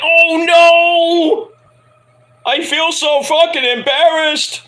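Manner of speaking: high and loud, slowed horrified wail; mortified shriek. Retold or imitated. Vlogger reliving spotting the typo, mortified shriek. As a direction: squeal it like you just saw your own typo. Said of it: "I see the comment. I'm like".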